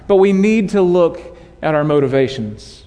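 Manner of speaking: ordinary talk, nothing marked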